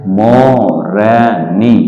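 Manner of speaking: मोरनी drawn out very slowly; मोरनी धीरे धीरे; slow stretched word. Shown as a demonstration. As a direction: sound out Morni slowly for children. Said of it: "धीरे धीरे बोलिए".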